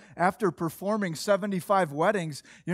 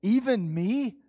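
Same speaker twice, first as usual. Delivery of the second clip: higher, rising incredulous question; disbelieving question; 4 semitones higher, slower than usual. Acted. Preacher wondering if the verse includes him, disbelieving. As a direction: ask it as if it can't include you. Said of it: "What it says to me".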